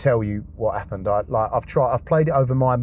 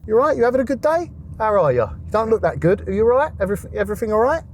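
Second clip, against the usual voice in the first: higher, lilting check-in for a mate; caring friend's voice; 9 semitones higher, faster than usual. Retold or imitated. A friend checks on him, higher and caring. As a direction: sound like a friend asking if he's okay. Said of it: "And that was".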